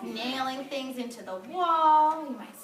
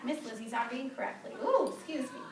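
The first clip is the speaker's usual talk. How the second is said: usual speaking voice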